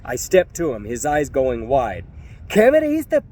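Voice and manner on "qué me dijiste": much higher and louder, stress on me; furious challenge. Retold or imitated. Narrator rounding on the thug, furious and loud. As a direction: bark it in his face. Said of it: "I said".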